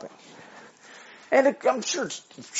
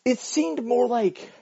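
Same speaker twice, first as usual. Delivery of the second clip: ordinary talk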